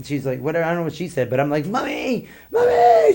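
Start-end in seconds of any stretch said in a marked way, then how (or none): 1.7-3.1 high shrieking yell for mommy, much louder; retold screaming for mommy. Tripping on shrooms, screaming at the girl at six a.m., shrieking yell. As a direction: shriek for your mom like a panicked kid. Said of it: "Just started screaming".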